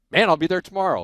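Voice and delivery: loud, high, retold eagerness; eager reply. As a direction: jump at the offer, replaying your own excitement. Retold eager answer to job offer.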